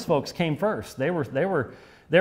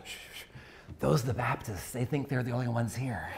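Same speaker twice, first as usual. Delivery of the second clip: shush, then speak much softer; low-volume joke punchline, as if not to be overheard; hushed confiding; 3 semitones lower, 12 dB quieter than usual. Retold or imitated. Heaven's guide hushes a newcomer passing the closed door, hushed confiding.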